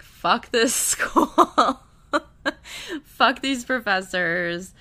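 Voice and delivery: loud, slow mock outburst with a laugh; retold snapping. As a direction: act fed up, half laughing. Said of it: "when it snaps for Naomi".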